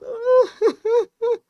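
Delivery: high, loud mock wail; imitated loud sobbing. Father breaking down before his children, imitated loud high crying. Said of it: "e chorava em alto".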